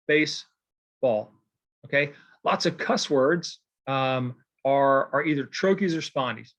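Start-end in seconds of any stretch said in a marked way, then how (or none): 0.1-1.3 "Baseball" slowly split into BASE, pause, BALL; two equal stresses. Shown as a demonstration. Teacher giving a spondee example, "Baseball" as two separate stressed syllables. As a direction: give both halves of "Baseball" their own weight. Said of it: "is two accented syllables".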